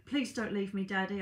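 ordinary delivery

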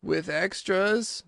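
slow, high, hesitant question; a little anxious. A narrator's reading. Mock Turtle asking Alice about school, mildly anxious. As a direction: stretch the question uneasily. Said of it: "a little anxiously".

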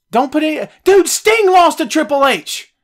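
getting heated about it; fired up. Real, not acted. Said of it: "God, I'm getting fired up".

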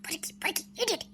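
breathy whisper of பொறுக்கி, பொறுக்கி, hardly voiced; whispered insult. Real, not acted. Aadhira whispering insults behind the policeman's back. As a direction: hiss பொறுக்கி so he can't hear. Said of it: "பொறுக்கி நான் திட்டிட்டு இருக்க?".

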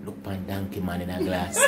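hushed and low, half laughing; mock whisper with a giggle; retold whisper. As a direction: slip the secret into a friend's ear. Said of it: "Me whisper in a man ears and say".

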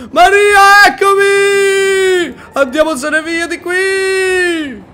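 high, loud, drawn-out shouts to get away; loud shouting. Real, not acted. Gamer shouting to teammate Maria to escape. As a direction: shout to flee.

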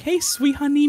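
high, sugary greeting act; mock sweetness. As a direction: flip into exaggerated cooing at a viewer. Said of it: "And then I'm like".

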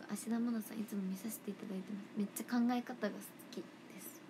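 hushed fast whisper, unvoiced; whispered message. Whispering quickly to a fan about Ashida Mana. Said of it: "モノマネをしましょう".

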